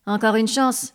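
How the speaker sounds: usual speaking voice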